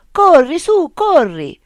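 high, loud urgent shout. Acted. Remo urging Cesare toward the light, loud urgent shout. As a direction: yell it so your friend runs now. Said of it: "gridò Remo".

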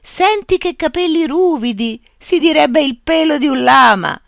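a blind man marvelling at a strange creature; Pedro marvelling. A narrator's reading. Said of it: "disse quello che si chiamava Pedro". (Pedro stupito, voce alta e lenta)

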